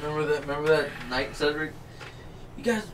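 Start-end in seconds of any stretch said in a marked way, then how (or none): 2.6-2.9 much higher-pitched mock mother; high-voiced warning from a mom; imitating friend's mom. Friend's mom warning drunk guests, high mock voice. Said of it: "Remember your mom?".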